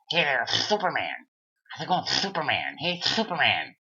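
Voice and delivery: mock him like a lisping cartoon cat; Sylvester-style lisp. Lightly lisping guy on the balcony taunting the man in the Superman shirt. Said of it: "like Sylvester the Cat making fun of me".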